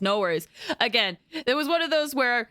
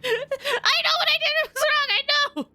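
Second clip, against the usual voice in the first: high squeak. Pretend. Mock guilty squeak at game.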